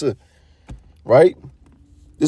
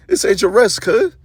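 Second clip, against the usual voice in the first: mocking oppressor; 8 semitones higher than usual. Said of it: "they have the nerve to laugh at you like".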